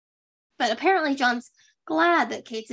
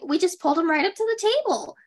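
mock amazement; 6 semitones higher, faster than usual. Acts out Kate over-explaining.

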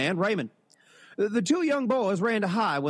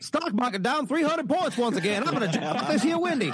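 a hint of an old-timey newsreader; lightly voiced newsreel announcer; 1950s narrator; 6 semitones higher than usual. Shown as a demonstration. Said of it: "old-timey voices".

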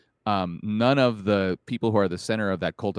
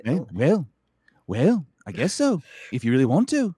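raised, hammed-up Scouse attempt; badly faked Liverpudlian; Liverpudlian accent; 4 semitones higher than usual. Shown as a demonstration. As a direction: try a lilting Liverpool accent. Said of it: "how do you do Liverpudlian?".

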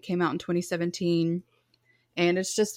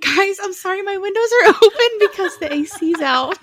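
much higher, louder, laughing; laughing apology; 12 semitones higher, 10 dB louder than usual. Real, not acted. Laughs apologizing for train noise.

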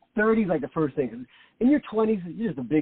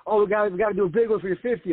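imitating eager kids; 7 semitones higher, faster than usual. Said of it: "that's what my kids are telling me".

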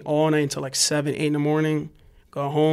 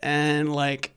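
normal talking voice

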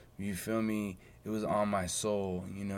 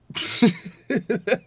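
loud high burst of laughter; 13 semitones higher, 13 dB louder than usual. Real, not acted. Host laughing at his own failed college plan, loud high laugh. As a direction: cackle out loud at yourself.

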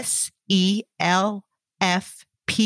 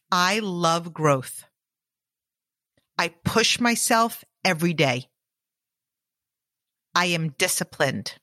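guided affirmations. Acted. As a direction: state each affirmation steadily for repeating. Recited affirmations for listener to repeat.

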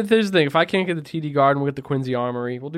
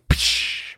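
breathy smash sound effect; bottle smash noise. Pretend. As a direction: spit out a glass-breaking crash.

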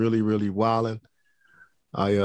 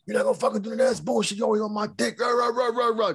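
imitating a raging kid, much higher; hammed-up teenage fury, pitched way up; mock student meltdown; 13 semitones higher than usual. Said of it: "just going crazy".

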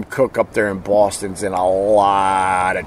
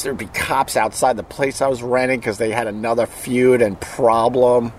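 normal talking voice

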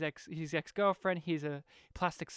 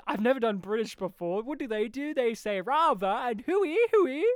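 mocking the actor; 3 semitones higher, 6 dB louder than usual. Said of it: "It's like J K said".

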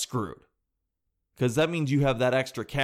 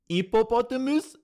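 high-pitched French-accented imitation, rising at the end; 9 semitones higher than usual. Host echoes the French girl's clip, high French accent. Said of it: "French girl trying to do an American accent".

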